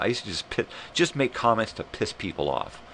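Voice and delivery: ordinary talk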